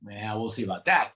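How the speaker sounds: low, doubtful we'll see about that; sceptical grumble. Retold or imitated. Wary newcomer answers the helpful guy, low and sceptical. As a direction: brush it off with distrust. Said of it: "I was like".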